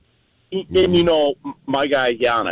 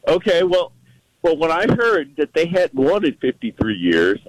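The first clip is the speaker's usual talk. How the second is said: normal talking voice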